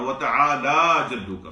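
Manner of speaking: teacher demo of وَتَعَالَى drawn long. Shown as a demonstration. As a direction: stretch the aa in وَتَعَالَى and raise it. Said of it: "اس کو تھوڑا لمبا کریں".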